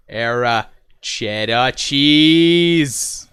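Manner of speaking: put-on very slow, drawn-out old-time politician voice; JFK voice. Streamer paying off the sixty-sub promise, slow drawn-out politician voice. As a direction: stretch each word like a stately speech. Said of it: "best impression of J F K".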